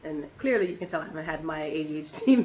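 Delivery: ordinary talk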